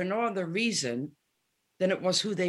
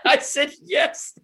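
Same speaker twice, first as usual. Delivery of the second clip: laughing; 9 semitones higher, 7 dB louder, slower than usual. Real, not acted. Retelling her own reply, laughing.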